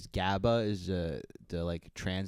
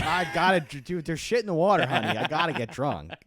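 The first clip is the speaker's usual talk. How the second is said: put-on drunk medieval husband; 5 semitones higher, 6 dB louder than usual. Medieval drunk excusing himself.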